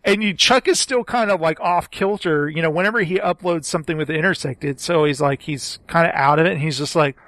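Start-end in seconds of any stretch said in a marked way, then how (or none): none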